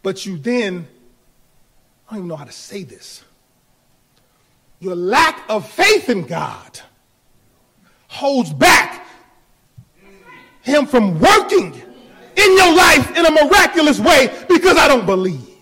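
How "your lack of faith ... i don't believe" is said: preaching at full force. Acted. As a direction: drive it home, louder and louder. Preacher rising to a shout.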